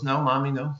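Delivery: put-on soft little-girl reply, trailing very quiet; a hint of a child, murmured; lightly voiced little girl. Little girl answering her mom, lightly voiced and soft. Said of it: "And the little girl goes".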